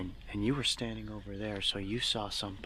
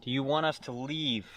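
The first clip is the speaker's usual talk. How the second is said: talking to a ghost; 4 semitones higher than usual. Real, not acted. Asking spirits during EVP.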